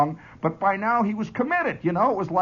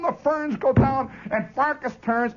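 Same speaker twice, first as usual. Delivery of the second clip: usual speaking voice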